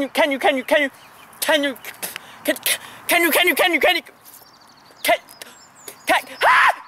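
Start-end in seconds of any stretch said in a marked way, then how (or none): 6.1-6.8 yelp. Acted. Sudden yelp mid-rant.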